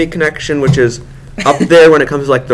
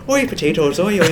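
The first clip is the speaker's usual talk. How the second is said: mock Irish; 4 semitones higher, 5 dB quieter than usual. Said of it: "that's an Irish voice".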